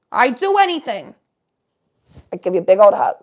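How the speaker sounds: high, loud hammed-up begging; pleading with a viewer not to leave; mock pleading. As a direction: beg them to stay, over the top.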